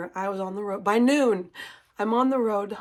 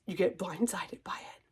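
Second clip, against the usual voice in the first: choked-up whisper; 3 semitones lower, 8 dB quieter than usual. Real, not acted. Tearful moment about stitching friends.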